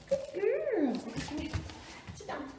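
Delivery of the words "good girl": squeaky praising a dog. Real, not acted.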